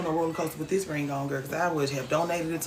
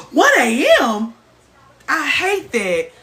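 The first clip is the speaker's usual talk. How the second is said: shocked gasp; 8 semitones higher, 9 dB louder, slower than usual. Real, not acted. Shocked at late finish time.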